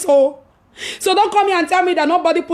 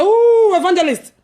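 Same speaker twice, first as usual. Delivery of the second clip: high, loud alarmed questions; playing a startled friend asking what happened; surprised friend; 8 semitones higher, 3 dB louder than usual. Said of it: "Gina was like".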